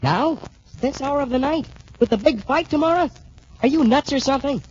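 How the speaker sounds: high, disbelieving questions; can't believe he's going out now; incredulous. Acted. Roommate astonished at late outing.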